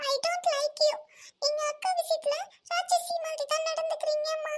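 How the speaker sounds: put-on high, bold child voice taunting; a small kid calling mum a demon; young daughter naming her mother a demon, high and cheeky; cheeky little girl.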